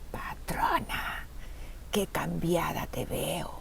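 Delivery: slow, hushed sneering jailer; thick mocking voice. A narrator's reading. Jailer Connie mocks her prisoner, slow hushed thick voice. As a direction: taunt slowly in a whispery thick voice. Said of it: "Se ríe con voz gruesa".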